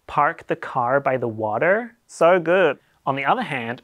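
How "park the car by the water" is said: Park the car by the water with every R curled, American style; rhotic American accent. Shown as a demonstration. Demonstrating a rhotic R to the viewers, American accent. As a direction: curl the tongue on each R of car and water, slowly. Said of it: "Sort of like this, hang on, get in character".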